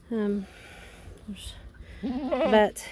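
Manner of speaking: ordinary talk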